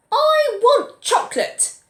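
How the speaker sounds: high, loud, slow bawl; bawling tantrum. Acted. Girl in a tantrum bawling for chocolate. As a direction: howl it like a furious little girl. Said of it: "bawled the girl".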